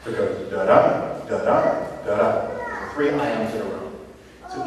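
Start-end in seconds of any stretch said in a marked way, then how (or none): 0.5-2.5 "da-da" with the second "da" punched; iambic stress. Shown as a demonstration. Teacher demonstrates an iamb, second "da" of "da-da" accented. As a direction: beat out "da-da" weak then strong, each pair separate. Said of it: "unaccented syllable followed by an accented one".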